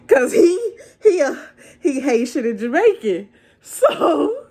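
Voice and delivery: high, laughing through the words. Real, not acted. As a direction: crack up while explaining. Laughs while explaining.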